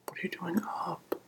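usual speaking voice

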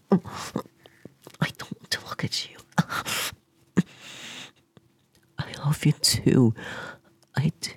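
very soft, slow tearful whisper; crying whisper. Acted. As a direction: whisper it as if you can't face him. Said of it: "I'm crying".

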